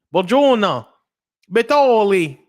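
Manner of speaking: high, loud hammed-up Italian greeting; mock Italian accent. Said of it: "Go say".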